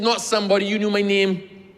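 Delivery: indignant outburst at the clerk; hot-headed retort. Retold or imitated. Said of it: "my head used to be hot".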